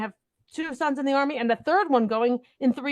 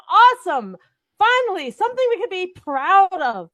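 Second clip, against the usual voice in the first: loud, high burst of delight; excited; 8 semitones higher, 7 dB louder than usual. Retold or imitated. Host retelling her joy at the history exhibit, loud and high. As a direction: cheer it like seeing the wall exhibit. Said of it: "And I was like".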